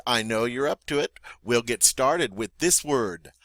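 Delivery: usual speaking voice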